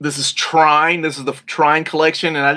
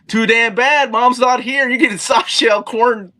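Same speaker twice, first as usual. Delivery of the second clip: raised-pitch mock scolding; fed-up dad; 7 semitones higher than usual. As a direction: lay down the law to a complaining kid. Acted retort of a fed-up dad.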